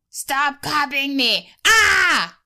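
high, slow shrieking cartoon yell; Finn as Crystal Guardian. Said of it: "My impression is gonna be the Crystal Guardian, Finn style".

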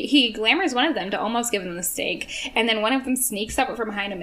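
ordinary talk, nothing marked

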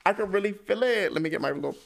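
high, loud, tearful squeak; really about to cry; on the verge of crying. Real, not acted. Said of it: "I'm gonna start crying".

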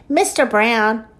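usual speaking voice

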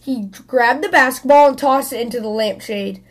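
plain reading voice

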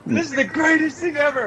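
very high overjoyed squeal; high-pitched, hammed-up joy; retold elation. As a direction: burst out with happy disbelief. Retold overjoyed reaction to pregnancy.

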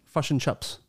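New Zealand "uh" vowels in "fish and chips"; New Zealand accent. Shown as a demonstration. Co-host contrasts two accents, New Zealand "uh" vowels. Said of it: "And New Zealand is".

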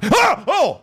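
high-pitched "Ah!" then "Oh!" of fake fright; acted startled cries; mock startled yelp. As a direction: jump as if spotting a critter. Acts out fright at imagined critter.

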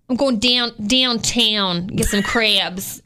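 drawn-out Maryland vowels; Maryland accent. Shown as a demonstration. Imitating how people back home speak, Maryland accent. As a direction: stretch "downtown" and "crabs" in a Maryland accent. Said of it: "They just say, like".

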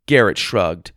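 ordinary delivery, nothing marked

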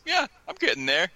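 modest rookie. Retold or imitated. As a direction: play it down, aw-shucks. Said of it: "he's just like".

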